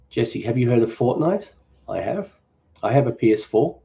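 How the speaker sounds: normal talking voice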